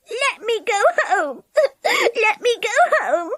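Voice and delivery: high, tearful wailing; weeping. A narrator's reading. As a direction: beg through tears. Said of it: "she wept".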